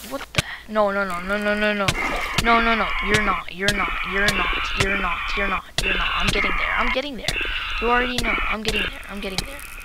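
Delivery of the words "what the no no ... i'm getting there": loud frantic denial; panicked denial. Real, not acted. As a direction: refuse it in panic. Fleeing a monster.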